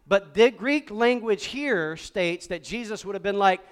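ordinary talk

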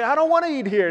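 put-on high, loud complaining kid; loud refusal. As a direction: act out a kid's indignant protest. Acted birthday restaurant quarrel.

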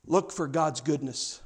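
ordinary talk, nothing marked

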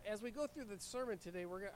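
ordinary delivery

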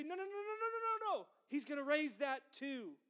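a string of emphatic protests. Retold or imitated. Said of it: "Paul and John and Peter say".

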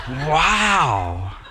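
drawn-out retold wow; amazed. Preacher recalling a stranger jumping at the name Jesus, amazed. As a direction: let the word swell in mock amazement.

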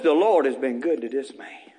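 hushed, choked-up whisper; tearful whisper. Retold or imitated. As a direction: whisper it as if holding back tears. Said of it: "He'd go to crying".

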